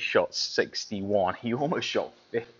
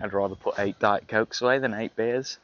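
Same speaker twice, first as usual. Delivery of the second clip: ordinary talk, nothing marked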